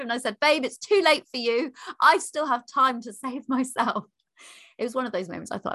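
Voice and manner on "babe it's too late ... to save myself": giddy urgent call. Retold or imitated. Racing past husband to toilet.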